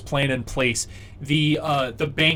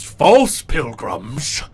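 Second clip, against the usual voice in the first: very slow, louder rising question; giant's menacing question; 6 dB louder, much slower than usual. Acted. Frost giant questioning Bubblegum, slow and rising. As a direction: stretch it out, savouring the words. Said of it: "[name] goes".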